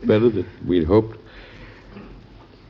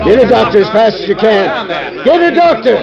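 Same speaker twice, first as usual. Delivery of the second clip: high, loud cry for a doctor; urgent shout; 13 semitones higher, 6 dB louder than usual. Acted. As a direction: shout it across the crowd. Calling for help after shooting.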